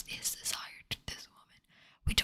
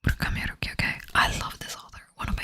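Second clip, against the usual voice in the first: excited; 10 dB louder than usual. Real, not acted. Gushing about favourite author.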